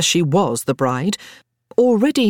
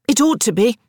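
higher-pitched, insistent comeback; retort; 7 semitones higher, 4 dB louder than usual. A narrator's reading. Elizabeth answers her doubting little sister, retorting. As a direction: answer back firmly. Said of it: "Elizabeth retorted".

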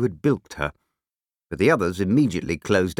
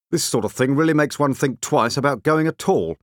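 higher, pointed complaint; unnecessarily loud; 6 semitones higher than usual. A narrator's reading. Scowling short man to the man beyond, pointedly loud. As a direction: complain pointedly so the whole queue overhears. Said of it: "rather unnecessarily loudly, to the man beyond him".

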